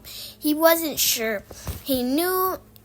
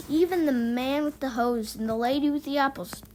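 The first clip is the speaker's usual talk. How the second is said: usual speaking voice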